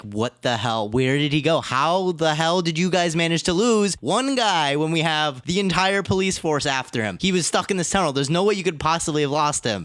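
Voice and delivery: mock exasperated rant, pitch climbing after the first question; frustrated detective. Lead detective berating cops for losing their man, frustrated. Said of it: "Just as frustrated".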